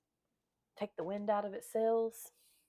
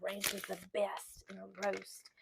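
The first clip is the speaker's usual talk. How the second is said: usual speaking voice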